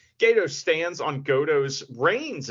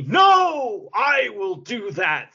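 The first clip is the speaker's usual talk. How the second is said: high, then slow drawn-out put-on voice; mock wrestler catchphrase; 8 semitones higher, 6 dB louder, much slower than usual. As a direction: ham up the wrestler's line.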